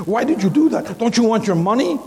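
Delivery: high, loud frightened pleading; scared scammer. Retold or imitated. Scared scammer calling back the preacher, high frightened voice. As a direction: squeal it like a panicked crook. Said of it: "He was scared".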